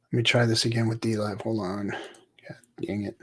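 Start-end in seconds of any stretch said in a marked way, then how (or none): 2.5-3.2 muttered curse in a whisper. Real, not acted. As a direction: swear under your breath. Cursing at computer under breath.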